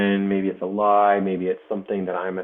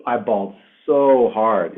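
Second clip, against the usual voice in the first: real tears rising, a bit louder and slower; choked-up real emotion, slower; getting emotional; 5 dB louder, slower than usual. Real, not acted. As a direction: voice catching as the memory hits. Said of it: "I'm getting emotional talking about it".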